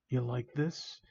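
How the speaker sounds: quiet, taunting question; demonic. Acted. Villain taunts victim, quietly demonic. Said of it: "chuckled that demonic voice of his".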